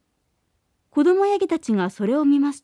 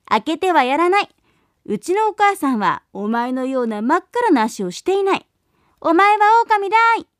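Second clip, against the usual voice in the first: put-on louder, higher children's shout; kids shouting; 4 semitones higher, 3 dB louder than usual. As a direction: shout the wolf away. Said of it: "と叫びました".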